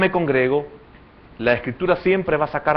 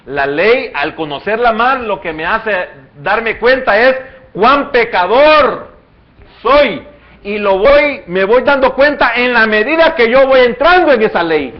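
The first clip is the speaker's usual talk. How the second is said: rising, louder sermon build; preaching at full force; 7 semitones higher, 8 dB louder than usual. Acted. Preacher driving the point home to the congregation, loud and rising.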